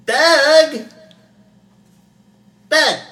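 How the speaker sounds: very high, loud shout with echo; echo effect. Retold or imitated. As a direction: yell the name so it echoes. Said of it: "You want the echo?".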